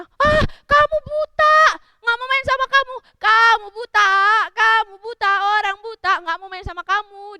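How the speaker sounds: put-on loud, high jeering children; hammed-up mocking rejection; bullying taunt.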